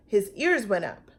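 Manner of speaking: plain reading voice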